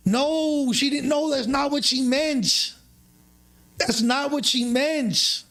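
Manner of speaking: hammed-up squeal of protest, way up high; panicked denial. Retelling his panic when she outed him to his girl, high frantic mock denial.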